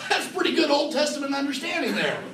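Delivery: chuckling high while speaking; laughing. Real, not acted. Preacher to his congregation, laughing with delight. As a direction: laugh through the line in delight.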